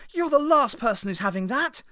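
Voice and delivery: high, loud furious retort; angry shout. A narrator's reading. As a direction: spit it at him. Ace furious at Vale.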